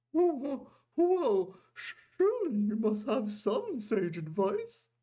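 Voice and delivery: very high, slow, shaky pleading; nervous stammer. A narrator's reading. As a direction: stammer it out, feigning calm. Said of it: "he stammered to his lieutenant".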